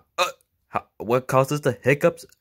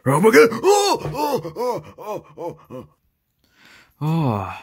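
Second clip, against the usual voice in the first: loud, high sick groans that fade; sick groaning; 4 semitones higher, 5 dB louder, slower than usual. Acted. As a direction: cry out as the nausea hits. Suddenly sick after eating.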